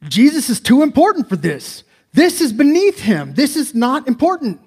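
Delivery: high, loud, scornful brushing aside; dismissive disciples. Retold or imitated. Preacher voicing the disciples brushing off children, scornfully. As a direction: act out the disciples waving the children off.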